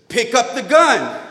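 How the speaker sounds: high, loud police command; shouted order. Retold or imitated. Officer ordering the driver to handle the gun, shouting.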